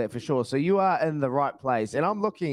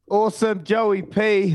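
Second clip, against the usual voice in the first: excited cheer; 7 semitones higher, 6 dB louder, slower than usual. Real, not acted. Host cheering off the guest.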